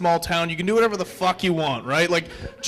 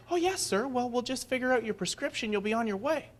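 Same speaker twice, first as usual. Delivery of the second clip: fake-nice clerk; 4 semitones higher, 10 dB quieter than usual.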